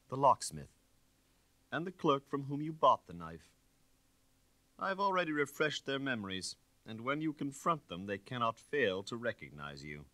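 an inspector cornering a suspect, dry and hard. Acted. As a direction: nail each witness down with firmness. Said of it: "His speech was dry and hard".

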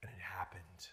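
very soft, whispered reveal; hushed awe. A narrator's reading. Preacher revealing the miracle to his congregation, hushed whisper. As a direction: drop right down to a whisper.

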